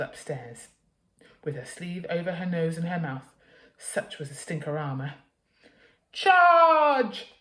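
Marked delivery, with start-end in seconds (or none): 6.1-7.3 very loud, high battle cry; bellow. Acted. Mother storming the messy room, bellowing. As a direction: charge into battle. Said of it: "she bellowed".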